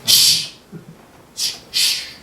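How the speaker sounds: sharp repeated shush; hissed shushes. Acted. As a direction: hush someone rudely. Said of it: "kalau dibentak gimana?".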